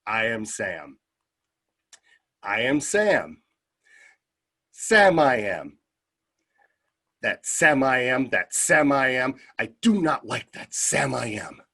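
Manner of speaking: slow, deliberate picture-book delivery; measured storybook lines with long pauses; reading to children. Retold or imitated. Visiting reader to kindergarten kids, slow read-aloud. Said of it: "I started reading to the kids".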